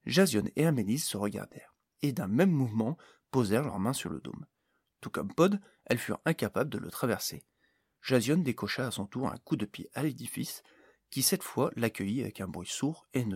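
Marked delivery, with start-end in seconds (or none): none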